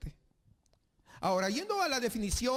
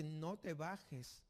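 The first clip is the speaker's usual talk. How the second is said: tender mother; 4 semitones lower, slower than usual. Retold or imitated. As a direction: gently hold the child back. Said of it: "Y la mamá le dice". (voz suave y lenta de madre que protege)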